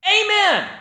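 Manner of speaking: high, loud relieved shout; desperate amen. Retold or imitated. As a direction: blurt it out at the last second. Rider finally remembers the command.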